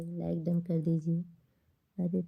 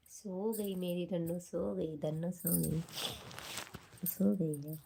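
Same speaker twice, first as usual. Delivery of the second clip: soft and tender; 7 dB quieter than usual. Real, not acted. Mother says daughter fell asleep.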